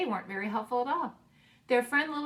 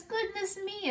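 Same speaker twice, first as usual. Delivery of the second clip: much higher, delighted exclamation; delighted gasp; 11 semitones higher, slower than usual. Acted. Bear marvels at Mouse's huckleberry pie, delighted and high. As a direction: sound thrilled at the pie.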